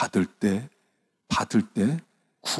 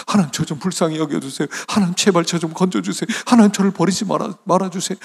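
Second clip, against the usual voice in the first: acted desperate plea; 7 semitones higher, 4 dB louder, faster than usual. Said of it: "네가 어떻게 부르짖어 봤어?".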